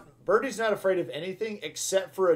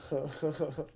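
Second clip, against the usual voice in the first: soft, short chuckle; quiet huh-huh laugh; 2 semitones lower, 8 dB quieter than usual. Real, not acted. Chuckles at his own wordplay.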